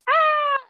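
very high hammed-up scream; mock scream. Villain screams as his arm is chopped, mock scream.

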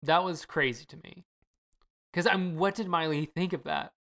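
usual speaking voice

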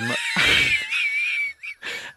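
high-pitched shrieking laugh; helpless high squeal. Real, not acted. Shriek of laughter mid-sentence.

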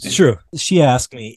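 ordinary delivery

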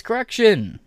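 sudden high yell; shouted correction. Real, not acted. Streamer cuts into the press release with a high yell. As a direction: bark the correction out. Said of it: "Correction, Texas".

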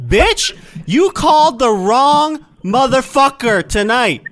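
furious shout down the phone; angry yell. Acted. Prank call, fake angry guy.

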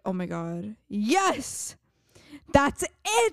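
rising to a delighted shout; excited eureka. Retold or imitated. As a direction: cry out like you cracked it. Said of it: "they're like".

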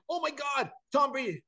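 high mock gasp of shock; mock shriek. Sister faking shock over Tom Brady, high mock shriek. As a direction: play the fake hysterical sister.

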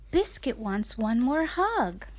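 plain reading voice

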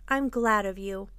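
ordinary delivery

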